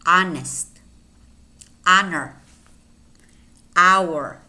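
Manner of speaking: no H in "honest, honor, hour"; silent H. Shown as a demonstration. Teacher listing examples, "honest, honor, hour" with silent H. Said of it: "in which the H is silent".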